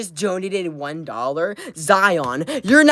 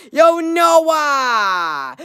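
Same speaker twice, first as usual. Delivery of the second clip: excited shout; 6 semitones higher, 4 dB louder than usual. Real, not acted. Hype shoutout for a donation.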